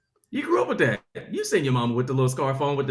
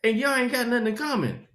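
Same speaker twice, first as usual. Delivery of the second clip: comic rant; 7 semitones higher, 5 dB louder than usual. Acted.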